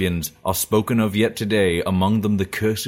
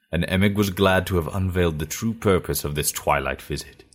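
ordinary delivery

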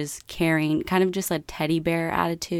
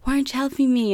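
higher-pitched annoyed complaint, retold; mad boyfriend; 8 semitones higher, much faster than usual. Boyfriend mad at his laughing girlfriend at drive-thru, mock annoyed. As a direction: imitate a boyfriend's exasperated protest. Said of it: "he's getting really mad at me".

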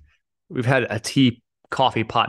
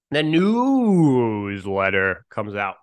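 drawn-out announcement; much slower than usual. Acted.

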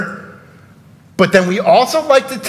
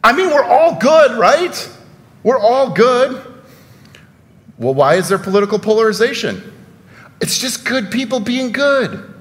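higher-pitched, mock-innocent sarcasm; sarcastic; 5 semitones higher than usual. Real, not acted. Preacher feigning that all people are good, sarcastic. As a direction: say it with raised pitch and feigned conviction. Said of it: "I'm being sarcastic a little bit".

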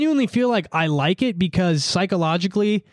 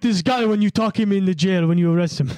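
put-on Indian accent, a bit higher; Indian-accented script reading; 3 semitones higher than usual. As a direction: imitate an Indian actor reading an American script. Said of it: "like an Indian American script".

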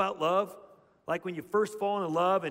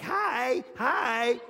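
higher, sing-out mock little-girl hi; little girls greeting; 6 semitones higher, 4 dB louder than usual. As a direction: imitate small girls chirping hello. Voicing little girls greeting each other.

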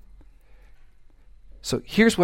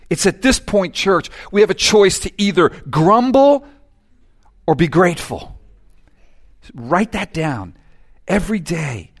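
ordinary delivery

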